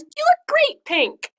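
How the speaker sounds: high, loud, slow call. Acted. Boy teasing Raphael: high called-out compliment. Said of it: "Tim called".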